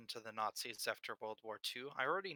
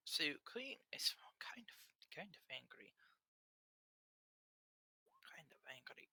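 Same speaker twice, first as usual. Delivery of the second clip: hushed whisper, no voice; 6 semitones higher, 8 dB quieter than usual. Real, not acted. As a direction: whisper every word. GM whispers throughout.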